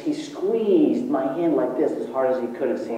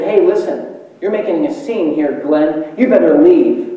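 imitating a bartender's scolding, louder and higher; stern order; 3 semitones higher, 6 dB louder than usual. Bartender telling a drunk dad to leave, loud stern order. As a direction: raise the voice to throw him out, loudest on the last words.

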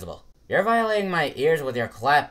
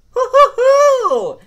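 high whooping laugh; 12 dB louder, much slower than usual. Acted. Thrill ride whoop.

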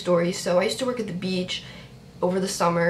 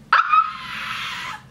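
very high-pitched squeal; real shriek of fan joy; excited scream. Real, not acted. Screams after the pick.

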